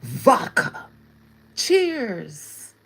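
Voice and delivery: loud, hammed-up Russian accent; best Russian voice. Toasting a Russian viewer with vodka, in a hammed-up Russian accent. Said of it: "In my, in my best Russian voice".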